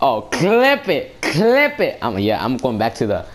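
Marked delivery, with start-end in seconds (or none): 0.0-2.0 high, loud, slow drawn-out call; excited demand. Real, not acted. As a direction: stretch it out begging to save it. Urging to save the clip.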